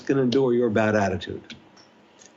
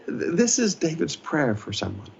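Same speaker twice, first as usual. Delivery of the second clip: ordinary talk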